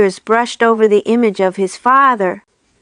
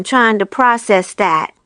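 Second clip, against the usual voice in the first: plain reading voice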